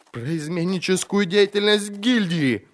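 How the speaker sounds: high, frightened outburst; blurted out. A narrator's reading. Merchant confessing to the inquisitor, blurting out. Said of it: "выпалил купец".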